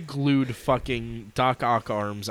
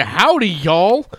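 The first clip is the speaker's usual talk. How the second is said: big drawn-out Texan howdy, louder; Texan accent; 5 semitones higher, 8 dB louder, slower than usual. Retold or imitated. Boxy company president greeting visitors, exaggerated Texan accent. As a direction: swing the pitch up and down in a Texan greeting. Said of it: "it's a fucking Texan accent".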